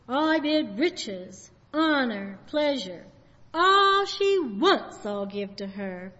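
slow tempting devil voice; drawn-out, tempting like the devil; deal-making devil. Retold or imitated. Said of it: "That was the devil".

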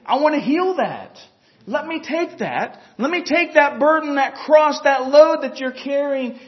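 the Lord calling out. Acted. Preacher voices the Lord.

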